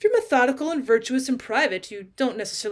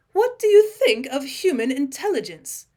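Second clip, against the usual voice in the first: a slow, higher, louder lame question; 5 semitones higher, 6 dB louder than usual. A narrator's reading. Mavis awkwardly questioning the talking cat, lamely and slowly. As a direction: ask it weakly, grasping for something to say. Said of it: "asked Mavis Pellington lamely".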